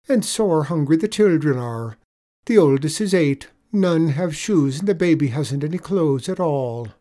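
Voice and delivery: leprechaun telling a pitiful tale. A narrator's reading. Leprechaun tells sad tale.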